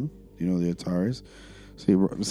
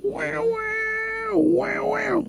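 very high wailing "wow-wow"; imitated music noise; much slower than usual. Revisiting the album, high imitated wailing noise. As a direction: wail it like a siren riff.

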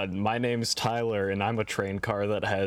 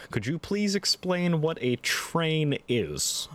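slow, higher mock question; mock-serious; 7 semitones higher, slower than usual. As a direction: ask it with fake earnestness. Mock naive podcast question.

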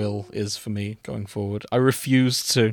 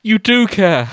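breathless, higher and louder in surprise; gasped; 7 semitones higher, 6 dB louder, slower than usual. Acted. Will to Hannibal, gasping in happy surprise. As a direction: catch your breath in delighted shock. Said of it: "Will gasped".

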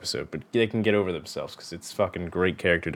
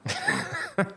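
a sudden squeal of a laugh, pitched way up; high squeaky laugh; 4 dB quieter than usual. Real, not acted. Host cracks up at his cohost's Boston accent, high laugh. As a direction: burst into a high-pitched giggle.

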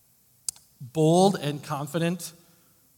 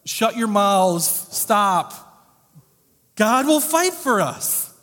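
higher, louder, slow sharp command; stern rebuke; 6 semitones higher, 6 dB louder, much slower than usual. Retold or imitated. Moses silencing the complaining people, loud slow rebuke. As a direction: snap them into silence. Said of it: "The phrase would be more like".

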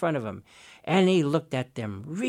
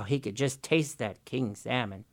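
plain reading voice

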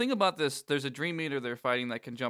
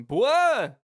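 put-on high, loud Texan bark of "Boy!"; Hank Hill; 10 semitones higher, 8 dB louder than usual. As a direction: holler it like an exasperated Texas dad. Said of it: "in Hank Hill's voice going".

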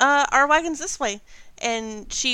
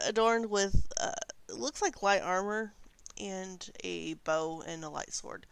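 ordinary talk, nothing marked

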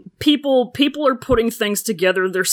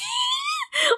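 high shrieking laughter; 5 dB quieter than usual. Real, not acted. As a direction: burst out in a squeal. Burst of laughter.

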